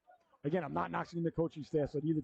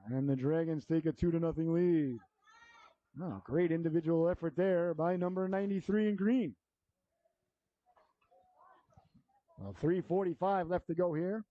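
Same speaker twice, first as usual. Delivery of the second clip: hockey announcer. Real, not acted. Live hockey play-by-play.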